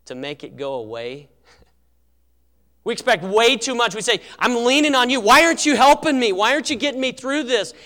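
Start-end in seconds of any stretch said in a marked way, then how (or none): 4.4-7.7 high, loud, exasperated pleading; acted demanding complaint. Preacher acts out leaning on a spouse, loudly complaining. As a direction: voice a fed-up spouse demanding help.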